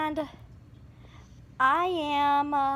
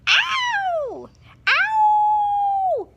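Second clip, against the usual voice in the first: very high pretend cry of pain; mock ow; 3 dB louder than usual. Child pretending Erica bit her, high mock yelps. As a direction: squeal ow like a hurt puppy.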